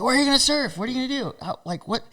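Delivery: high-pitched imitation of puzzled surfers; mock questioning. Surfers questioning him at Pipeline.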